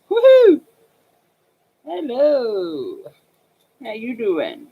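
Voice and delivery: high whoop sliding into a silly drawn-out hello; mock tipsy. Joking how one wine hits them, mock tipsy. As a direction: play giddy after one glass of wine. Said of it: "if I have a glass of wine".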